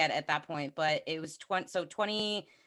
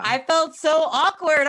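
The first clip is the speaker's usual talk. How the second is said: emphatic; 9 semitones higher, 8 dB louder than usual. Real, not acted. Recalls awkward moment.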